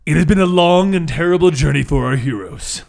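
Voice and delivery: dramatic recap narration; story narration. Acted. Game master opens the recap with storyteller narration. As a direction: open the tale like a storyteller.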